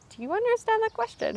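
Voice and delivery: imitated interviewer, pitched far up; high-pitched mock interviewer question; retold careful questioning. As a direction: ask it high, as if checking on a beginner. Retold interviewer checking she understood.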